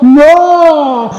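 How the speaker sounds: high, loud mock yell of "No!"; kid's yell. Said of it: "And he'd yell out".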